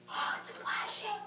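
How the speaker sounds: hushed, slow, awed whisper. Real, not acted. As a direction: breathe it out in wonder. Awed whisper at flashing toy.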